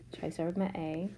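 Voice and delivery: usual speaking voice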